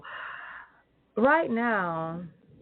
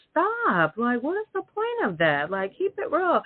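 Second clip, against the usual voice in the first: fed up, tell them to stop; exasperated outburst; 8 semitones higher than usual. Real, not acted. Telling liars to stop, high and exasperated. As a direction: pitch jumps high, exasperated.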